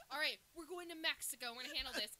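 rally them with mock resolve; mock take-charge. Character announcing the plan in the car, mock take-charge.

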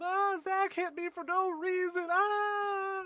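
high, slow, whining tattle; whiny tattletale. Retold or imitated. Little sibling running to mom, high slow whine. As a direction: wail it like a hurt little brother. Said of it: "he just runs up".

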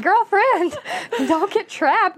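high, laughing plea to a TV character; exasperated mock warning. Fan yelling at the screen about the attacked girl, high and laughing. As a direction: scold her like a friend, half laughing. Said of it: "I was like".

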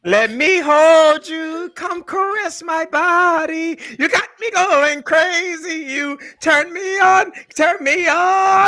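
put-on slow sung Jamaican accent, pitched high. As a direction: croon it in a bad Jamaican accent. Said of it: "Your Jamaican accent's terrible".

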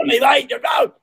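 high, shouted mock Cockney; over-the-top Cockney street cry; Cockney accent. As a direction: yell it out as a hammed-up Cockney. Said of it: "better than sounding Cockney".

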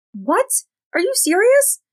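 stunned, voice jumping up high; outraged "are you serious"; shocked disbelief. Acted. Nix stunned by the news.

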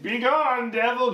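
high, chanting command; mock exorcist. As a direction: intone it like a hammy exorcism. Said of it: "you're like".

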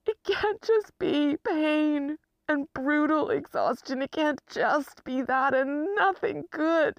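pleading despair. Real, not acted. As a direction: let the voice lift and drag in grief. Pleads about pain and exhaustion.

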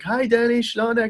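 mock cheerful aunt saying hi; relatives' greeting. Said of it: "They're like".

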